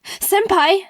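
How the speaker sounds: high, loud delighted cry; overjoyed shout of senpai; excited squeal. Retold or imitated. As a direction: squeal it like a thrilled fan. Excited reply to his message.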